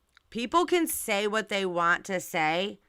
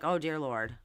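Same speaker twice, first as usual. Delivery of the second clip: low, soft mock prayer; understated dread; imitated dismay; 4 semitones lower, 6 dB quieter than usual. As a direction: sink into a quiet, stunned reaction. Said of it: "that face is like".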